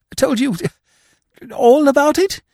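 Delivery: high, strained disbelieving question; pallid dismay. A narrator's reading. Freddy learns his uncle told Eve, pallid dismay. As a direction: question it in appalled alarm. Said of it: "Freddy was muttering pallidly".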